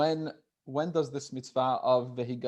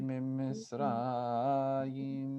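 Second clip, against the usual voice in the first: slow, drawn-out chanted reading of each phrase; very slow read-along; much slower than usual. Shown as a demonstration. Teacher reading the paragraph slowly for students to follow, drawn out. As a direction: lead the class through the Hebrew word by word, slowly. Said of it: "I'll read it very slowly".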